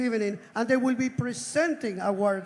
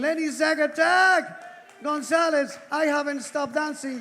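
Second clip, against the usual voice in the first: calling out; 5 semitones higher, 4 dB louder than usual. Real, not acted. Spotting a friend across the room.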